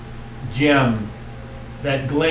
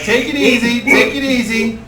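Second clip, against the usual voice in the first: higher, laid-back stoner; stoner druid; 9 semitones higher, 3 dB louder than usual. Acted. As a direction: coax someone to relax. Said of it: "He's like".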